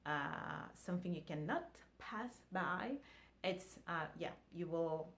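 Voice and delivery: normal talking voice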